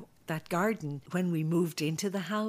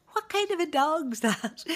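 put-on high, loud puzzled kid's voice; mocking Dublin kid; 11 semitones higher, 6 dB louder than usual. Dublin kids asking about the goat, high loud puzzled. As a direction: play a baffled street kid. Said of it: "they'd be like".

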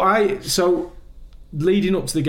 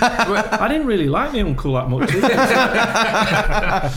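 laughing; 9 semitones higher, 5 dB louder, slower than usual. Real, not acted. Guest laughing at own regret.

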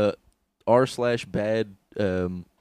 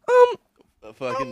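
Gary Vee impression; 3 dB louder than usual. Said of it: "an amazing Gary Vee".

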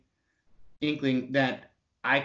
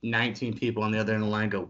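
normal talking voice

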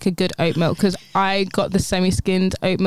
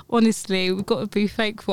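ordinary talk, nothing marked

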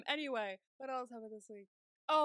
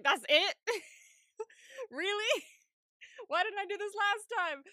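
incredulous surprise; 9 semitones higher, 7 dB louder than usual. Retold or imitated. Retold relief at computer fix.

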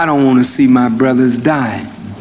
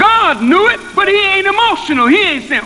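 much higher, ringing and quick; preaching climax. Acted. Minister crying out to the congregation at the peak, preaching. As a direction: cry it out at the top.

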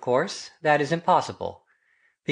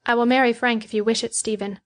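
higher-pitched, quick voice of Betty; meek words; 7 semitones higher than usual. A narrator's reading. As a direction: voice a meek young woman, pitched up. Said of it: "her words were meek enough".

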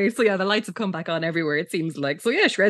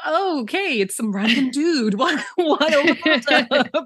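baffled; 7 semitones higher, 4 dB louder, slower than usual. Retold or imitated. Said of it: "I was like".

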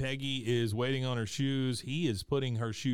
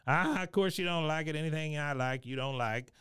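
higher-pitched impression of a griping father; imitated cantankerous piping-up; Cotton; 3 semitones higher than usual. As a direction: pipe up with a needling complaint. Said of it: "Cotton pipes up again".

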